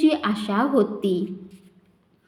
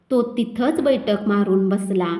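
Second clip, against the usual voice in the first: ordinary delivery, nothing marked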